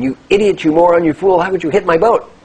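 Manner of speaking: high, loud furious tirade; acted outraged shouting; angry screaming. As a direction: scream insults at the other boatman. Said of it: "starts screaming".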